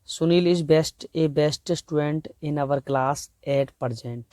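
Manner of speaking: very slow, word-by-word reading of a test sentence; slow dictation. Shown as a demonstration. Teacher reads a grammar question aloud, very slowly. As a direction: read it out slowly so students can spot the error. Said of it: "तो इसमें कहीं एक गलती है".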